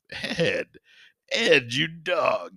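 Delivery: slow drawn-out teasing Ed; playful mock scold, slow and high. Real, not acted. Teasing the witness.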